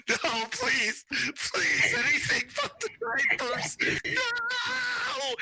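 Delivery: high, hammed-up pleading wail; panicked begging. Husband dragged off to diaper duty, mock-desperate begging. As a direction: wail comically, laughter breaking in.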